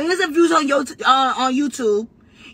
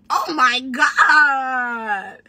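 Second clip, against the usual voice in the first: laughing cry; much slower than usual. Real, not acted. Laughing at the rival.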